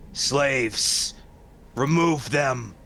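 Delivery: loud, high undead command; commanding shout. Acted. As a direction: bark the order at servants. Mummy commands servants.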